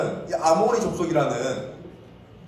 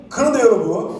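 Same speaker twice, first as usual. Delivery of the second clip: higher, louder suspense cue; dramatic cue; 5 semitones higher, 5 dB louder than usual. Shown as a demonstration. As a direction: announce a twist and invite the response. Cueing children's dramatic response.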